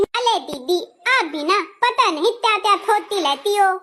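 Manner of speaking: put-on childlike lisp with r turned to l. Bride chiding her sister-in-law, lisping. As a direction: lisp it, softening hard consonants into t and l. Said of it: "अभी से तोतलाने लगी".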